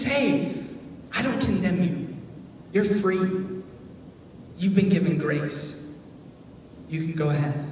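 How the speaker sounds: soft, quiet, slowing; gentle release. Retold or imitated. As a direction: let her go kindly. Jesus releasing the woman.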